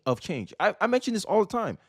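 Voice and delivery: usual speaking voice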